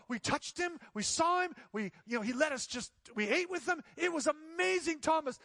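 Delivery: high, loud, breathless excitement; excited telling. Retold or imitated. Disciples to Thomas, overjoyed and loud. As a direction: burst out with the news like a disciple who just saw a miracle.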